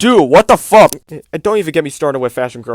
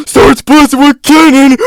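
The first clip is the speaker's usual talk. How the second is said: high, loud mock shout; hammed-up battle cry; 8 semitones higher, 8 dB louder than usual. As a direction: yell like an overexcited kid. Mock cannon rant.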